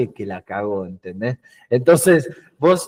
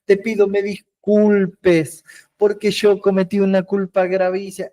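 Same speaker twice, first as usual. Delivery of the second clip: mock crying plea; 6 semitones higher than usual. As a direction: whimper it like a weepy self-made victim. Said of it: "estar llorando así".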